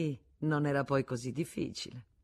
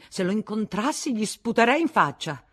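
loud, high angry threat; spiteful threat; 5 semitones higher, 7 dB louder than usual. A narrator's reading. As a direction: threaten with contempt. The Vatnaz rages at Del Mar. (minaccia rabbiosa e sprezzante)

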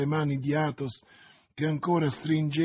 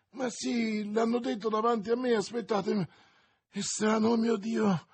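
high, light, anxious searching voice; faint, distressed; 6 semitones higher than usual. A narrator's reading. Dying woman trying to recall a name, faint distress. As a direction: grope for the memory, pitched high.

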